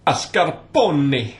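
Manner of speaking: loud, drawn-out Italian mascarpone; hammed-up Italian accent on "Mascarpone", stretched slow. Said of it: "For that Italian accent".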